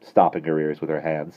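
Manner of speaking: plain reading voice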